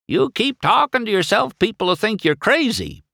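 put-on high, loud, tart old lady car; crabby old car setting him straight; cranky old-timer teasing; old Lizzie, scolding.

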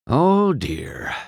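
high, feigned dismay in an even voice; understated, unbothered "oh dear"; flat voice. A narrator's reading. Judge stalled at the starting line, flat voice. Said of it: "Doc said in a flat voice".

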